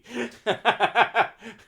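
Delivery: high burst of laughter; big loud laugh. Real, not acted. Laughs at own joke.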